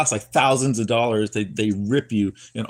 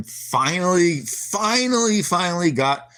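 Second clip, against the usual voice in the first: elated finally; 7 semitones higher than usual. Real, not acted. Relief at finally scoring.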